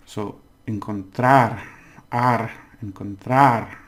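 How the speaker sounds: encontrar slowly and loud with the last syllable stressed; final stress. Shown as a demonstration. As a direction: land on the -trar of encontrar. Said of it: "remember it ends in R".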